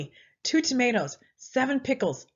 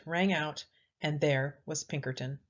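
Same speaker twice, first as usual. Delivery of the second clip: plain reading voice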